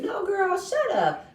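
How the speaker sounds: high, laughing shut up; laughing mock shutdown. Laughing while quoting the girl telling her to shut up.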